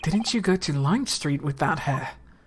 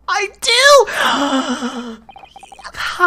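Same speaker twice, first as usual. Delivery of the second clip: very high, loud, breaking into a gasp; excited squeal; 13 semitones higher, 6 dB louder than usual. Real, not acted. Player greets a new character, squealing with excitement.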